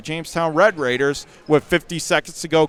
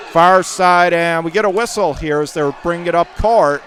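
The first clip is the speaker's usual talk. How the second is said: ordinary delivery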